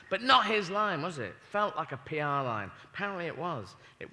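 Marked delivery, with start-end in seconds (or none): none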